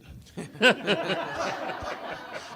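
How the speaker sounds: airy wheezing laugh; breathy laugh. Real, not acted. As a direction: laugh silently. Unvoiced laugh.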